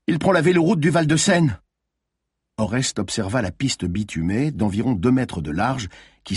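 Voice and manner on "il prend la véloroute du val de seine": high, excited call; urgent alarm. A narrator's reading. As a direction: call out the escape route urgently. Calling out the fugitive's route.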